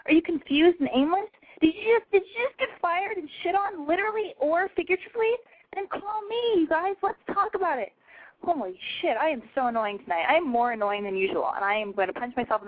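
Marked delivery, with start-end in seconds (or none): 0.0-7.9 excited pitch. Acted. Pitching callers, voice climbs high.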